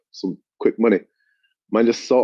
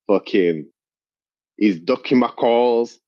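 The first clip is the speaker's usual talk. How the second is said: ordinary delivery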